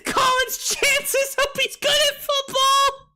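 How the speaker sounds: very high squeal through real laughter; hysterical laughter. Real, not acted. Joking about future kid's college, helpless laughter. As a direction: barely squeeze the words out while cracking up. Cracking up at own joke.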